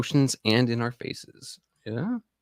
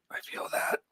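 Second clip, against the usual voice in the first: breathy whisper to himself; whispered aside; 9 dB quieter than usual. Real, not acted. Streamer agreeing quietly with veterans, whispered. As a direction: say it under your breath.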